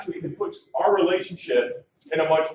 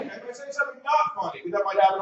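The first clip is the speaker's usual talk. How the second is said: ordinary talk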